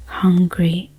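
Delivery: plain reading voice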